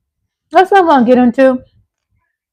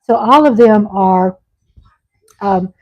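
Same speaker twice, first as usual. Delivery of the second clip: usual speaking voice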